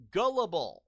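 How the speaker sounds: loud emphatic. Real, not acted. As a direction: snap the word out. Ranting at viewer.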